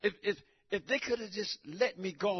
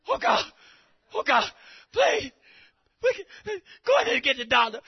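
panicked plea; 5 semitones higher, 5 dB louder than usual. Retold or imitated. Wife's panic when he collapses.

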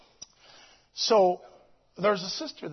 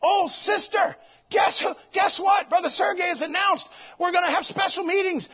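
high, loud, eager phone news; best friend calling, bubbling with excitement; excited friend; 7 semitones higher, 3 dB louder than usual. Retold or imitated. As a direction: burst out with the big news. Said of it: "It's her best friend in Moscow Church".

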